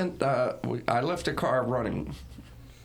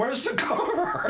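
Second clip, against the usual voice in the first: high, slow alarmed cry through a laugh; 13 semitones higher, much slower than usual. Retold or imitated. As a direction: act out a panicked parent, laughing. Panicked parents retold at window.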